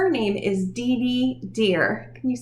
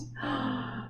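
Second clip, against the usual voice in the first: mock gasp; 12 dB quieter than usual. Excited teaching moment.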